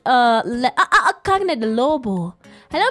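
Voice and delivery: usual speaking voice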